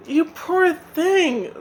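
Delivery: mild teary sympathy; tearful pity. Acted. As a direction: crocodiles pitying Spider. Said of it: "they cried, sniffing loudly".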